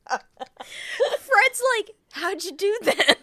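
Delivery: high laughing mock astonishment; giggling as she quotes the amazed husband; laughing disbelief. Real, not acted. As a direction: squeak it out through laughter.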